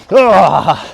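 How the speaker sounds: excited grunt. Real, not acted.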